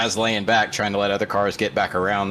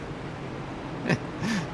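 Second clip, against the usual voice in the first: soft airy chuckle; quiet laugh under the breath; breathy laugh; 10 semitones higher, 9 dB quieter than usual. Real, not acted. Co-host laughs at remark.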